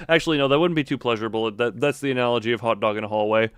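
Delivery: ordinary delivery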